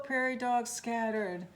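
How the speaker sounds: ordinary delivery, nothing marked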